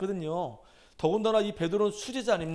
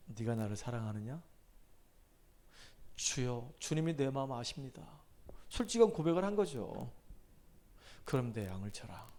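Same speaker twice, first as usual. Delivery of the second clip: much softer, hushed and gentle asking; tender question; 7 semitones lower, 10 dB quieter than usual. Acted. Risen Jesus gently asking Peter about love, tender hush. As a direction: ask it softly, as if sparing him.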